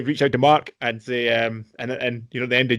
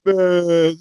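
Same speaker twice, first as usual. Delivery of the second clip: louder, higher stretched name; mock Matt Damon; 7 semitones higher, 8 dB louder, much slower than usual. Host echoes the Matt Damon bit, louder and higher. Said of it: "Matt Damon's voice".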